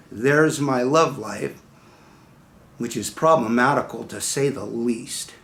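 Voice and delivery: ordinary talk, nothing marked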